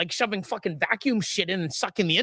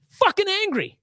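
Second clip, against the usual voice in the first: high-pitched angry outburst, falling hard at the end; real anger; 10 semitones higher than usual. Real, not acted. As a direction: declare your anger outright. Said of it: "I'm fucking angry".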